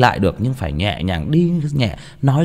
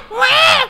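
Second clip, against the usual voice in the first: put-on baby wail; 5 dB louder than usual. Imitating baby's cry.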